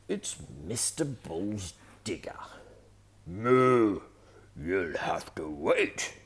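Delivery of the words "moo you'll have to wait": loud, slow bellowing moo; bellowing bull. A narrator's reading. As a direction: boom it out like a big bull. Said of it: "bellows Mister Bull".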